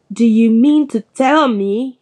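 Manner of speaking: loud, indignant growl; growled accusation, pushed out loud; angry growl. A narrator's reading. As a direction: confront them in outrage. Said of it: "he growled at the Dursleys".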